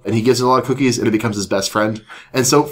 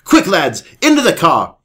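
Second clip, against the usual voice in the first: higher, urgent British rallying call; British accent; 7 semitones higher, 3 dB louder than usual. Acted. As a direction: hurry them along in a British accent. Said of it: "He's British".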